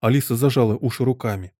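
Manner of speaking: plain reading voice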